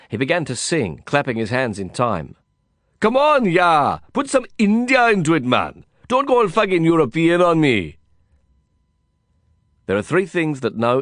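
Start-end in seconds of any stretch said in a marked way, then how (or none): 3.0-8.0 put-on high, loud Indian-accented exhortation; exuberant Indian cowboy. Cowboy friend urging Indian style in the dance, loud and high. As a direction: egg him on loudly, pitched high.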